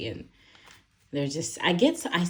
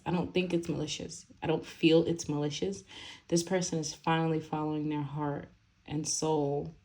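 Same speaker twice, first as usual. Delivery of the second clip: ordinary talk, nothing marked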